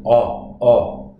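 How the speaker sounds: O sung out as a New Zealand vowel. Shown as a demonstration. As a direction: lean on the Kiwi O, firm and open. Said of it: "a sound we make a lot in New Zealand".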